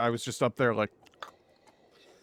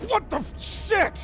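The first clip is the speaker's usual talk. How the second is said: very high startled yelp; real startled scream; slower than usual. Real, not acted. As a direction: shriek a curse in sudden shock. Startled by sudden explosion in game.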